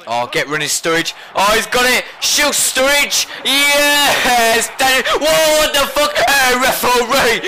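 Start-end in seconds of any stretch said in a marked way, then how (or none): 2.2-5.0 urging shoot. Real, not acted. 5.2-7.4 outraged whoa. Real, not acted. Outraged at foul in game.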